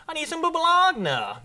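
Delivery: high, loud mock Pittsburgh yinzer voice; Pittsburgh accent. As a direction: ham up a Pittsburgh local asking for baloney. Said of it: "you think it'd be like".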